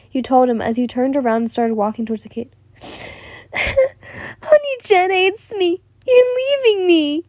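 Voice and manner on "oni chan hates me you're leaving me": very high, slow wail; crying out. Acted. Boy left behind by his big sister, high crying wail. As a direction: wail it like a heartbroken child. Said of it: "he cried out".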